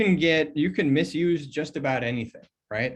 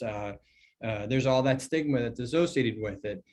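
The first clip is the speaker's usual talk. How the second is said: usual speaking voice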